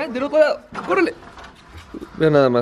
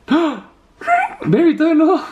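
startled shout. Real, not acted. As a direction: yelp when interrupted. Interrupted outro, startled.

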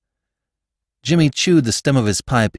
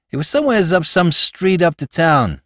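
lightly voiced New York street crook; a hint of a Bowery rogue, eager to help; slangy small-time crook talking to his boss; Spike, slangy New York crook; 7 semitones higher, 4 dB louder than usual.

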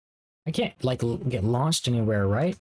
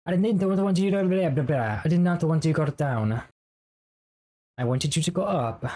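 higher-pitched, hammed-up Italian accent; bad Italian accent; 5 semitones higher than usual. Gamer scolding Mario for going down, in bad Italian accent. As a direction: put on a clumsy Italian accent. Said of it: "I apologize for my bad accent".

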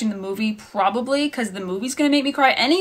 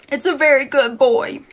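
higher, louder, cooing a good dog; doggy praise voice; 4 semitones higher, 5 dB louder than usual. Pretend. Reviewer jokingly praises the book like a pet, in a cooing dog-praise voice. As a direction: praise it like patting a dog on the head.

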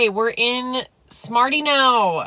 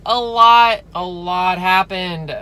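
loud exclaim; 7 dB louder, slower than usual. Real, not acted. Reacting to yesterday's events.

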